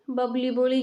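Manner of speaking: plain reading voice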